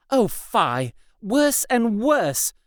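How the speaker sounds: slow, hammed-up despair; milk the mock tragedy; theatrical lament. A narrator's reading. Anne to Ursula, hammed-up slow lament. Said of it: "theatrically clinging to a bedpost".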